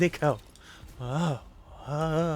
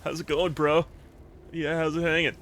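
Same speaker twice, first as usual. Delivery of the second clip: laid-back California surfer bro, slightly higher; Californian surfer dude; 3 semitones higher than usual. Acted. Streamer showing chat the accent they love, Californian surfer dude. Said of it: "I love California surfer, surfer dude".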